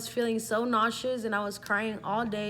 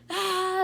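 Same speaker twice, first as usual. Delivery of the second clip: high, loud mock scream of shock; acted-out scream; 11 semitones higher, 8 dB louder than usual. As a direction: reenact screaming at bad news. Reacting to positive pregnancy test.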